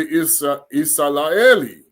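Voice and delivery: Isalaeli with an l, Isa-la-e-li; Kikongo name. Shown as a demonstration. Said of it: "This is what it really is".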